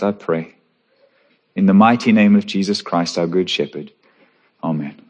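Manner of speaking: hushed congregational prayer. Acted.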